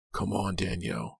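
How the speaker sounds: soft, hushed urging. A narrator's reading. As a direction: coax her softly to follow. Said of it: "he urged".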